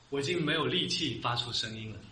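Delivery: mildly acted exhaustion, voice sinking; drained of strength. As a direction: let the voice sag, spent.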